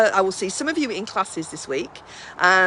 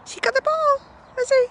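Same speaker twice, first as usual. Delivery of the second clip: much higher coo; 4 dB louder than usual. Real, not acted. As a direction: coo at the dog with the ball. Cooing at dog with ball.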